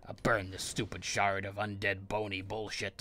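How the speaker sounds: usual speaking voice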